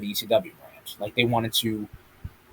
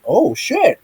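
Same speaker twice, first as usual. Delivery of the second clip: much higher, shocked repeat; stunned high-pitched exclamation; 10 semitones higher than usual. Retold or imitated. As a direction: squeal it out like a stunned fan. Fans reacting to surprise title match.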